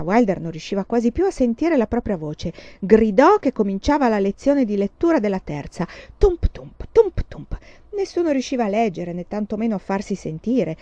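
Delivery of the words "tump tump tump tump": loud rhythmic thumping; strong beat, weaker echo; bench thumps. A narrator's reading. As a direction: bang out the rocking bench in pairs. Imitated rocking bench.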